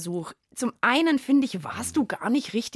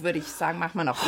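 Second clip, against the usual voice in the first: usual speaking voice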